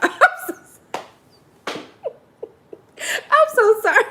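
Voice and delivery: breathless giggling through sorry; can't stop laughing while apologizing; laughing apology. Real, not acted. Host apologizes to viewers for mixing up names, laughing.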